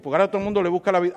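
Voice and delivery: ordinary delivery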